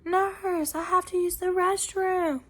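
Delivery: high, sustained call for the nurse; retold call, pitched well up; patient calling out. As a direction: imitate a patient calling from the bed. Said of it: "there was another lady in the room".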